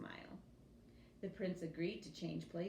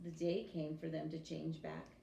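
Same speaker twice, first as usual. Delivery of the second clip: ordinary delivery, nothing marked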